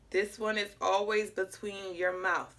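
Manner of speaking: ordinary delivery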